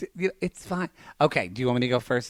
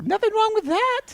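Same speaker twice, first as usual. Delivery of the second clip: high squeaky defensive line; 3 dB louder than usual. Pretend. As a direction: squeak out an innocent excuse.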